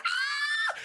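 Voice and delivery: high startled shriek; imitated scream. Girl ambushed on her walk, high scream. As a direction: shriek in sudden fright. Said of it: "and she went".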